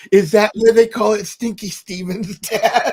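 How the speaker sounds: put-on loud, high-pitched kid's question; excited young daughter. Daughter asking Dad about the restaurant's name, loud excited kid. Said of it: "And Jessica goes".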